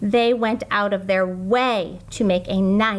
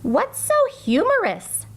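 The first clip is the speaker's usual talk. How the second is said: high lilting teacher voice; music teacher asking; 11 semitones higher than usual. A narrator's reading. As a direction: ask it sweetly like a dreamy teacher. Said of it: "asked Missus Twinkle".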